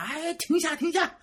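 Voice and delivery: high-pitched imitation of mum calling out; 喊人停下. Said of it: "我妈还要喊他". (学妈妈尖声喊停)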